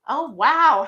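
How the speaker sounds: normal talking voice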